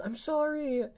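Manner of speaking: high loud sorry; exclaimed apology. Real, not acted. Streamer apologising loudly to chat when emote fails. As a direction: blurt the apology out.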